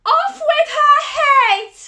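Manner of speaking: the Queen of Hearts condemns Alice in a slow screaming fury; furious shriek. A narrator's reading. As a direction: scream the sentence with all your rage. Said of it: "shrieked the Queen of Hearts".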